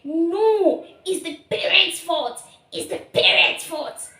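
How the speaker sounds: high, insistent retort; stubbornly high-pitched denial; indignant protest. Retold or imitated. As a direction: protest that it's all the parents' fault. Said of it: "And I remember that I was like".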